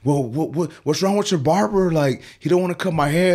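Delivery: high-pitched, indignant imitation of a customer; complaining client. Client complaining to shop owner about barber, aggrieved. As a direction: stammer into a baffled, aggrieved complaint.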